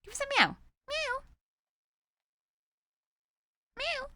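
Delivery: squeaky high meow to the cat; high mock meow. Owner meowing at her cat to make it answer, high squeaky meow.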